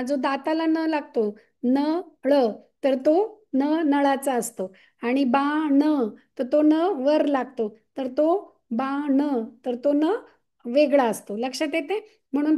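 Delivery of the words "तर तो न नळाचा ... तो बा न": न said slowly and separately in तो न नळाचा; drawing out न and बा न to show the sound; slow sound demo. Shown as a demonstration. Teacher to parents, slowly sounding out न. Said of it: "हे नचा आहे ना".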